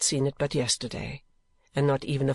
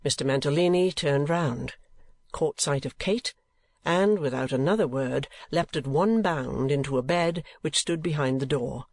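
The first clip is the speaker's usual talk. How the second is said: ordinary delivery, nothing marked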